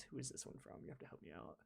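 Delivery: soft unvoiced whisper. Real, not acted. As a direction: whisper it aside. Whispers question off-mic.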